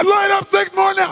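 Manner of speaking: high, very loud order; unhinged, explosive command; crazy screaming. Retold or imitated. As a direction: scream it ten times crazier. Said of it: "I go ten times crazy".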